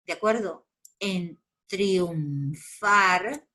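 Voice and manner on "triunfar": "triunfar" very slowly as triun-far; slow teaching breakdown of "triunfar", keeping triun together; syllable by syllable. Shown as a demonstration. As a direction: draw out "triunfar" in two syllables. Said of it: "separo en sílabas".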